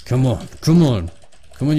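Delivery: ordinary delivery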